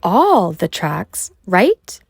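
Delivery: put-on doubtful girl. Mulan questions Mushu's story.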